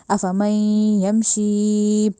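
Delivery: अफमन यमशी chanted very slowly with long ya; slow recitation. Shown as a demonstration. Teacher recites the phrase for pupils, very slow. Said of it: "यमशी कहना है".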